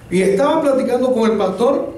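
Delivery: normal talking voice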